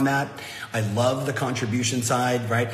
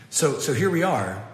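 ordinary talk, nothing marked

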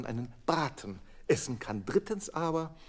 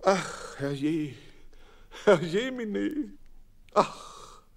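lament; 6 semitones higher, slower than usual. A narrator's reading. Narrator laments hens' plight.

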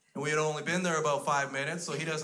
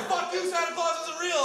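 very high squealed retort; hammed-up falsetto yell; panicked mock shriek; 4 dB louder than usual. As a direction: screech it at the kid in terror. Shouting back at scary child.

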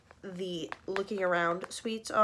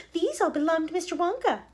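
higher, louder indulgent mom; mother's voice; 9 semitones higher, 5 dB louder than usual. Acted. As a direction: gently correct her daughter.

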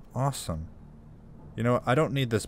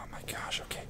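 softer, whispered relief after a fright; shaken whisper; 11 dB quieter than usual. Real, not acted. Player collecting himself after a scare, whispering. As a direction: whisper it to steady yourself. Recovering from fright while hiding.

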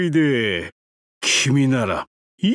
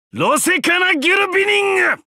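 grand proclamation; 12 semitones higher, 3 dB louder, faster than usual. Acted. Proclaiming his own name.

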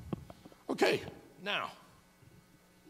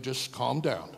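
normal talking voice

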